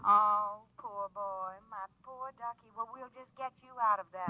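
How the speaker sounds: low, hushed seductive purr; sultry flirt. Acted. Lorene luring Frank to come over, low seductive purr. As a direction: lure him with a low, lonesome coo.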